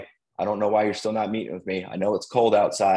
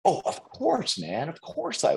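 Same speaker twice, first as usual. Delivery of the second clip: much higher, eager dude voice; imitating buddy; 10 semitones higher than usual. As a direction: gush the yes like a happy pal. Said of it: "that's how he talks".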